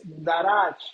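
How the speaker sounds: "darach" stressed on the final A; stress on second A. Shown as a demonstration. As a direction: say "darach" with the weight on its second syllable. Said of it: "con acento en la segunda A".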